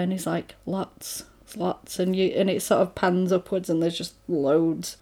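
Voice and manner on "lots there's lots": hushed whisper of an answer; whispering back to a friend. Retold or imitated. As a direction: breathe it out under the breath. Whispering quote in retold film.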